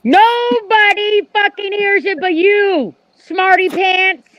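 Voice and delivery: high, slow, drawn-out scolding; exasperated. Acted. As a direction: scold him slowly. Still berating Mark.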